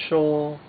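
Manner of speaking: "sho" high, level and loud; first tone. Shown as a demonstration. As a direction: hold "sho" high on one pitch. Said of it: "which is a first tone".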